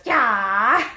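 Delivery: loud yell that swoops up at the end; Link's battle cry. Acted. Storyteller voicing Link fighting Ganondorf, loud rising battle yell. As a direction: throw out a long rising attack shout. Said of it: "And he goes".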